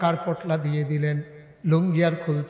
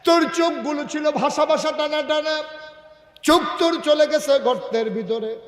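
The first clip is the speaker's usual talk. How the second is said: high, loud, anguished exclamation; full-force preaching; 11 semitones higher, 9 dB louder than usual. Acted. Preacher voices the shocked Prophet.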